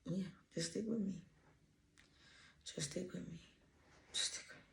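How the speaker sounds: very soft, under-the-breath aside; hushed, absent-minded reassurance; quiet murmur. Real, not acted. Murmured asides while concentrating on the reading.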